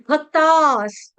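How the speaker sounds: "भक्तास" drawn out, ending on s; broken up slowly. Shown as a demonstration. Teacher splitting the word for students, slow "भक्तास". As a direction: hold the long aa, then close "भक्तास" on s. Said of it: "तोड़ तोड़ के पढ़ा दिया".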